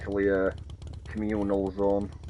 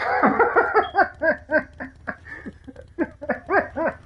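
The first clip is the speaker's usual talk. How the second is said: long rising squeal of laughter; high laugh. Real, not acted. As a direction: burst into high cackling laughter. Laughing at own joke.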